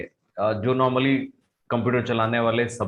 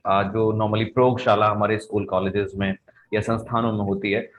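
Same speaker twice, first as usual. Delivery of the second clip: usual speaking voice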